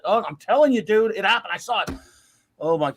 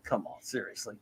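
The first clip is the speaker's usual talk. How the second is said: much lower and softer, disbelief; disbelieving mutter; 9 semitones lower, 13 dB quieter than usual. Retold or imitated. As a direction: mumble it, not buying it. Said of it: "And I said".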